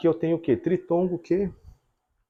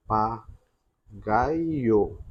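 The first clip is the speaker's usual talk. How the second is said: "Papagaio" slowly split as pa... pa... gai... o; teacher dictating "Papagaio" in slow, separate beats; syllable by syllable; 4 semitones lower, much slower than usual. Shown as a demonstration. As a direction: draw out each syllable of "Papagaio". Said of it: "Vamos separar silabicamente?".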